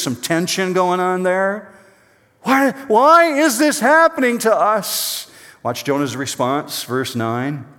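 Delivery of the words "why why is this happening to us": high, slow, wailing despair; panicked cry. Acted. Pastor voicing sailors in the storm, slow high wail.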